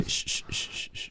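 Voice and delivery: soft unvoiced shushing; mock shushing. Girlfriends comforting a sobbing woman, soft mock shush. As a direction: hush a crying friend. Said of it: "They're like".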